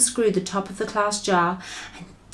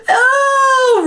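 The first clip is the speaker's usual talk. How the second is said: high, loud nightmare howl; roar; 9 dB louder than usual. A narrator's reading. Giant roaring in his nightmare, loud high howl. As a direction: cry out in full-throated terror. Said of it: "roared the flesh lump eater".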